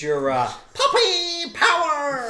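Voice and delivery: high, slow cartoon puppy voice; Scrappy Doo voice. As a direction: yap it like a tiny pup.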